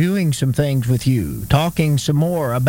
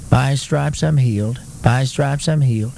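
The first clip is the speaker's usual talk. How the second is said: imitating a half-hearted, unconvinced recitation; mock feeble confession, evenly droned; feeble attempt; 3 semitones lower than usual. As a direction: recite it without emphasis or belief. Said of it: "make maybe a little feeble attempt".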